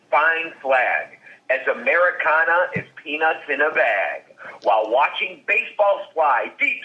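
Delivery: mock-grand poetry reading, unhurried; poem recital. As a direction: recite the ode like a proud poet, savoring each line. Reads his own hot dog poem aloud.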